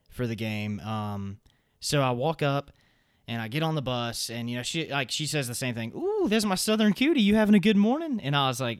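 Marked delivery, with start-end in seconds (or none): 6.0-8.2 high-pitched gushing Southern woman impression; imitated Southern accent. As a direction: put her greeting in a high Southern accent. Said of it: "put her in a Southern accent".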